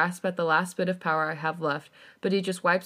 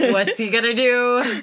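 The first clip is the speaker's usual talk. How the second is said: 1 laughing; 5 semitones higher, 7 dB louder, slower than usual. Real, not acted. Laughing excited listener question.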